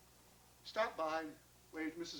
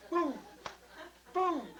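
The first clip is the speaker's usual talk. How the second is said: booming thump; 9 semitones higher than usual. A narrator's reading. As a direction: imitate a ball thudding, punchy. Imitates dough basketball bouncing.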